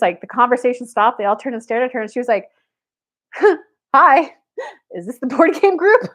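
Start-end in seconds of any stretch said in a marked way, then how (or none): 3.3-6.1 imitated nervous laugh, high and loud; nervous laughter. Newcomer greeting a board game group, nervously laughing. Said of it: "had that nervous laughter".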